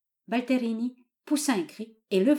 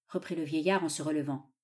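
ordinary delivery, nothing marked

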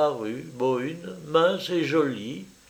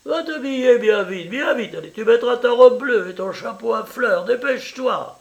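higher, effusive Italian mother welcoming a guest; eager landlady mother; 10 semitones higher, 6 dB louder than usual. Acted. Mother welcoming her daughter's French lover, eager and high. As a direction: gush over the visitor, pitched up.

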